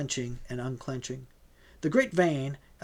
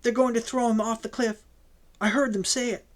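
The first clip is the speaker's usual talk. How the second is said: higher, tearful urgency; a young woman sobbing out a warning, higher; distraught; 7 semitones higher, faster than usual. A narrator's reading. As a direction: plead through the fear.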